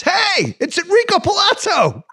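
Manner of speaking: very high, excited mock shout; Chubby. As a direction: call it out like a thrilled spectator. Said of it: "Chubby from Teen Wolf goes".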